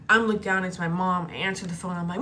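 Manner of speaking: ordinary talk, nothing marked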